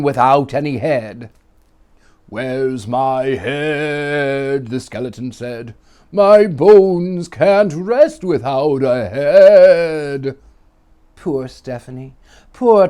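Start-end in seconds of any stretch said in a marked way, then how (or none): 2.3-4.7 very slow, hollow ghostly moan; spooky skeleton. Acted. Headless skeleton asks in story. 6.1-10.4 very slow, louder, higher ghostly wail; wailing skeleton. Acted. Skeleton wails in story.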